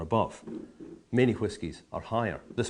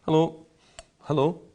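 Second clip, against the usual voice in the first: mock greeting; 6 semitones higher, 7 dB louder than usual. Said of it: "only by going".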